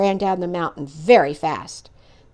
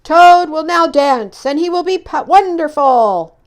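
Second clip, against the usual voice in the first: booming showman's announcement; strange far-off voice; 6 semitones higher, 8 dB louder than usual. Acted. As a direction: announce it grandly from afar. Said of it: "said the voice".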